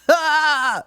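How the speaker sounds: high, loud fake laugh; hammy high laugh. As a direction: laugh at your own joke. Character laughs at joke.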